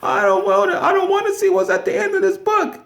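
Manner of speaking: very high, complaining puppet-like voice; whining to God. Retold or imitated. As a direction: whine up high like a reluctant child. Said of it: "And he's like".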